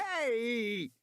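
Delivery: sharp high yelp; shout. A narrator's reading. Man knocked down by a dinosaur, shouting. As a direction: cry out as you go down. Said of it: "Regis shouted as he fell".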